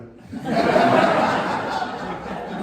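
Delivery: a big real laugh; hearty laugh. Real, not acted. Teller cracking up at his own story, laughing. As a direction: laugh out loud at the memory.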